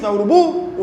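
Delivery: "urubu" punched high on the final BU; stress on last syllable. Shown as a demonstration. Teacher checking with students, "urubu" stressed on BU. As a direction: lean hard on BU in "urubu", as a check question. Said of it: "O forte é o bu".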